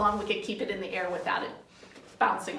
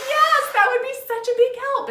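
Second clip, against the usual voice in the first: loud, high, gushing yes; exaggerated grateful enthusiasm; thrilled mom; 13 semitones higher, 10 dB louder than usual. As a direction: act out a mom delighted by help. Mom thrilled at offer to help.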